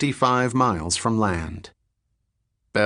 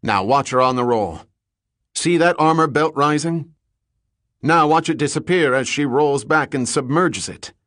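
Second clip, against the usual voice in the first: higher, pressing commentary; lightly voiced emphatic captain; 5 semitones higher, 3 dB louder than usual. A narrator's reading. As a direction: urge a companion to watch. Captain directs attention to roll.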